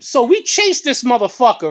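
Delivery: usual speaking voice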